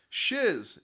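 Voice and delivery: sharp startled exclamation; exclaiming. Acted. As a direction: blurt it out in shock. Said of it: "exclaims Layton".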